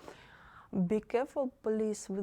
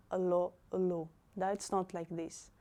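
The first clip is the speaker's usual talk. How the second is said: normal talking voice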